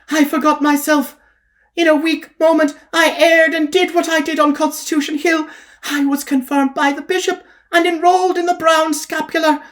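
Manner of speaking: high, confessing woman's voice. Acted. Kitty confesses her fall to the preacher. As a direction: own up to sins with mock shame.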